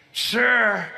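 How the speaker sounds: a hint of a polite officer; pilot voice. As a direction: lightly voice the crew member asking. Pilot voiced in retold story.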